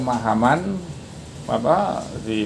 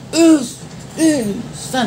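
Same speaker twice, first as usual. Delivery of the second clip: shouted count; 13 semitones higher, much slower than usual. Shown as a demonstration. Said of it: "disuruh ngitung dengan teriakan yang keras". (teriakan hitungan latihan bernada tinggi)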